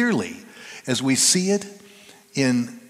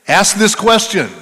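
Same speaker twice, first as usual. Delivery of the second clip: higher, louder command from God; commanding call; 7 semitones higher, 10 dB louder than usual. Acted. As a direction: give the order with authority. God speaking through the pastor.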